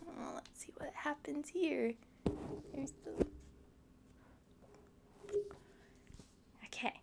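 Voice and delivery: hushed, breathy aside; whispered aside. Real, not acted. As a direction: lean in and whisper. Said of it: "hushed aside to viewers".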